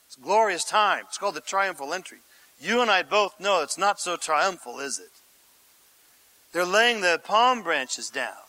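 usual speaking voice

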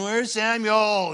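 impatient Saul. Retold or imitated. Saul impatient, waiting for Samuel.

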